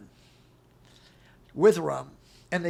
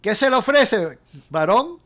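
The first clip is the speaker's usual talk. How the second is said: taxi driver in Spanish; 3 semitones higher, 8 dB louder than usual. Retold or imitated. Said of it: "he says to me in Spanish".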